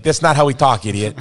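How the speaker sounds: annoyed Boston-accent retort, played loud; mock offended Bostonian. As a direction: snap back as an annoyed Boston local. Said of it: "You're like".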